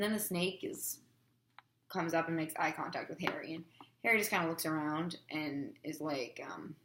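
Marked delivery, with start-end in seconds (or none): none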